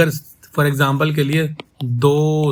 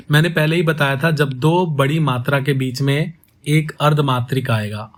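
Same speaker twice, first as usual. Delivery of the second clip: ordinary delivery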